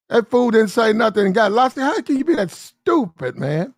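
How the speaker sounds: high-pitched, incredulous complaint; exasperated disbelief. Real, not acted. Man ranting about friend who said nothing, exasperated. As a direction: marvel at the stupidity, voice climbing high.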